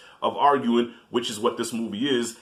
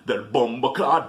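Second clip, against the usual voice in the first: put-on Jamaican-accented curse running into booming noises; slow mock Jamaican cursing, then thudding fight sounds; Jamaican dad; slower than usual. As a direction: imitate a Jamaican father swinging punches. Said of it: "her and her dad both are Jamaican".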